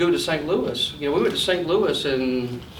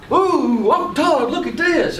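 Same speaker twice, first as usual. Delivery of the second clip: put-on much higher, thrilled kid gasp; light up at the big toy; excited little boy; 10 semitones higher, slower than usual. Boy sees the Power Wheel.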